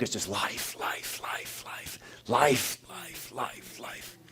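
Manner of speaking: breathy repeated life fading into a whisper. Acted. Pastor lingering on eternal life, fading whisper.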